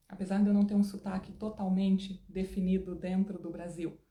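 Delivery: normal talking voice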